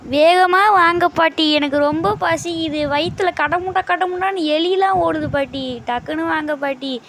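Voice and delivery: loud, whiny call for granny. Acted. Hungry grandson shouting for grandma to come quickly, loud and whiny. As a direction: yell for grandma to hurry up.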